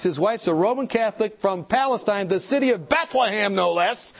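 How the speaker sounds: ordinary talk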